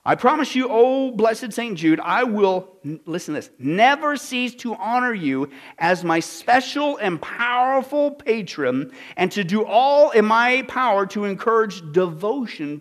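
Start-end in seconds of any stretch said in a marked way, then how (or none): 0.0-2.8 higher, drawn-out invocation; declaimed prayer reading. Acted. Preacher quoting a vow to Saint Jude, declaimed. 3.6-12.8 slow, emphatic, stretched vow; declaimed prayer reading. Acted. Preacher quoting devotion to a patron, declaimed slowly.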